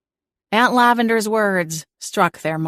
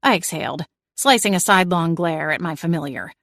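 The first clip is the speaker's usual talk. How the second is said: usual speaking voice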